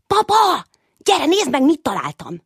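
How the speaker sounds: excited call. Acted. Little Ödönke calls his father over, loud and excited. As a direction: shout for papa, thrilled. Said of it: "kiáltotta izgatott hangon".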